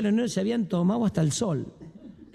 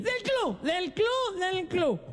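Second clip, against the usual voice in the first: put-on very high, soft drunk whine; 11 semitones higher, 3 dB quieter, much slower than usual. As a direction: squeak the excuse. Drunk answers wife.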